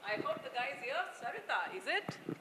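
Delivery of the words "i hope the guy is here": normal talking voice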